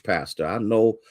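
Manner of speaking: usual speaking voice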